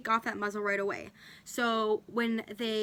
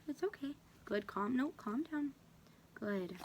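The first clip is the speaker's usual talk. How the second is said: much softer, soothing the anxious dog; calming the dog; 2 semitones higher, 9 dB quieter than usual. Real, not acted. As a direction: hush him gently down.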